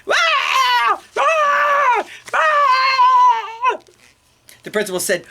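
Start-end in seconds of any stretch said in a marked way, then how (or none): 0.1-3.8 put-on piercing, very high baby scream. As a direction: scream loudly like a furious baby. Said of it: "it screamed really loudly".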